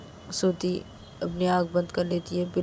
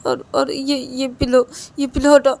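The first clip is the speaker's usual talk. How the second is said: tearful demand; 6 semitones higher, 7 dB louder than usual. A narrator's reading. Demands pillow removed.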